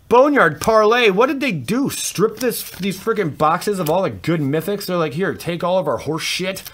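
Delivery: high, loud outburst of disbelief; exasperated rant. Real, not acted. Card opener complaining about stripped boxes, exasperated rant. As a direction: rant in outrage at being cheated. Ranting at bad card pulls.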